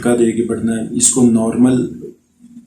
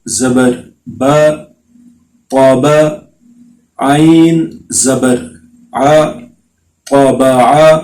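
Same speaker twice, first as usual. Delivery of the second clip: slow, clear qaida spelling chant; measured teacher recitation; slow syllable drill; much slower than usual. Shown as a demonstration. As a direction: spell each letter and vowel out for the children.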